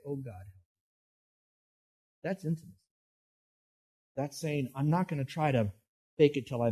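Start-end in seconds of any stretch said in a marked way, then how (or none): 0.1-0.8 much softer, a hushed plea to God; hushed prayer. Shown as a demonstration. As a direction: pray it quietly, almost to yourself.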